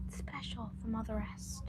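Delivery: whispering. Retold or imitated. Daughter mimicking mom's gushing.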